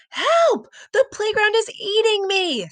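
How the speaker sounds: high panicked shout. Acted. Sam pulled under by the sand, screaming. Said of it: "Sam shouted".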